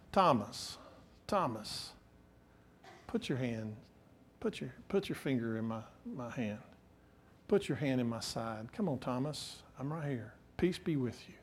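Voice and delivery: very soft, low, tender Jesus; gentle invitation. Acted. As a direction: quietly coax a doubter closer. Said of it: "He invites us closer. He told Thomas".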